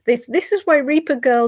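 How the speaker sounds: ordinary talk, nothing marked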